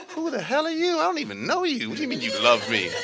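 very high, laughing mock outrage; suspicious parent. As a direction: play the wary mother.

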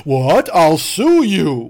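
loud, slow mock outrage; mock outraged customer. Customer threatening the manager, loud drawn-out mock outrage. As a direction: stretch out the threat indignantly.

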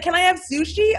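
high, eager hopeful question. Retold or imitated. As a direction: ask it like a kid hoping for yes. Actress voiced, hopeful question.

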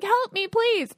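high mock plea; lightly voiced needy child begging; pleading inner child. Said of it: "all their wounded inner child stuff".

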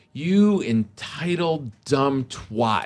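slow, venomous retold insult; retold angry snap. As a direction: spell out each word, imitating your own snap. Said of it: "And I just snapped".

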